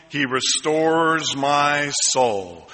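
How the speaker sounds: slow, deliberate scripture reading; solemn slow line for the congregation to echo; slow recitation. Shown as a demonstration. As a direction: let each word land slowly. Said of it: "Can you say that with me".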